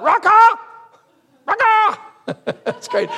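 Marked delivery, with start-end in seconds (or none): 0.0-0.6 high, loud squawk of an insult; crow-like shout. Acted. Preacher wrestling with flesh and blood, crow-like shout. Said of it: "Like a crow". 1.4-2.0 high, loud squawk of an insult; crow-like shout. Acted. Preacher wrestling with flesh and blood, crow-like shout. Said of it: "Like a crow".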